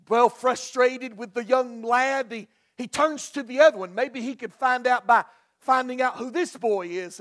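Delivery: normal talking voice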